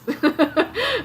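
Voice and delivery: bright burst of real laughter, high and loud. Real, not acted. Reading tarot to viewers, breaks into a loud laugh. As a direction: crack up laughing at your own words.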